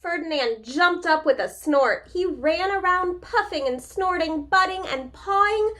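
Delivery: patient picture-book voice with pauses; reading to children. A narrator's reading. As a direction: read it like a teacher at story time.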